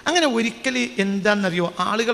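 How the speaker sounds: ordinary talk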